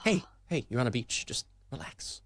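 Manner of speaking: calm him down, hushing; mock soothing whisper. Friend soothing the angry coach on the beach, whispering.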